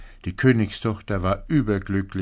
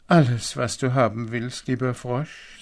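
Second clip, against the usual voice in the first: higher princess voice, louder on the first word; eager princess; 5 semitones higher, 5 dB louder than usual. A narrator's reading. Princess eagerly promises anything. (eifrige Prinzessin, mit hoher Stimme)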